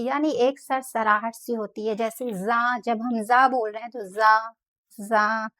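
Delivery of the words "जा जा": जा with a whistling hiss on the z. Shown as a demonstration. Teacher demonstrates the whistling letter to students, hissing. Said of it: "सीटी जैसी आवाज़ निकलती है".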